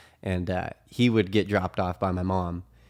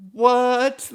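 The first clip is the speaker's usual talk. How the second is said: loud, high, drawn-out mock disbelief; confused; 7 dB louder than usual. Dude behind the angry housemate reacts, imitated loud confusion. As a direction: squeal it in exaggerated bafflement. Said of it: "now he's so confused".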